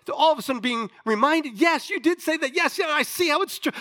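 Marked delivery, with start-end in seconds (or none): 1.6-3.7 excited realization. Acted. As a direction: burst out with joyful recognition. Disciples realizing the resurrection.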